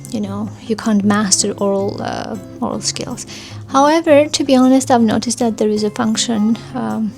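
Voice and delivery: usual speaking voice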